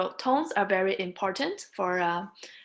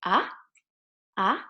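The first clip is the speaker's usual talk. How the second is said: "ah" rising sharply like a question; second tone. Shown as a demonstration. Teacher explains second tone, "ah" rising like a question. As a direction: ask "ah?" with the pitch shooting up. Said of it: "like a question".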